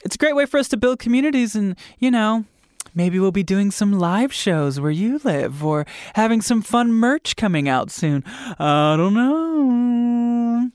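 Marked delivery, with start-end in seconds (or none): none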